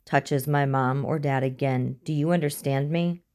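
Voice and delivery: usual speaking voice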